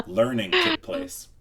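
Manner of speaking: mock solemn. Real, not acted. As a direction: announce it like a lesson learned.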